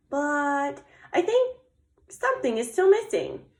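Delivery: high, sing-song little bear voice; Little Bear pondering. Acted. Little Bear studying her snowman, high pondering voice. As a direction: ponder aloud like a small storybook bear.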